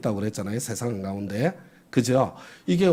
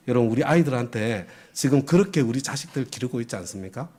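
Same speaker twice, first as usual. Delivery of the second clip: normal talking voice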